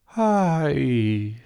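langes, träges Seufzen; Seufzer. A narrator's reading. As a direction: seufz es schläfrig heraus. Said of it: "sagte das Faultier und seufzte". (schläfriges, langgezogenes Ai)

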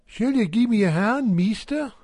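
a lightly voiced local carter; carter's voice. A narrator's reading.

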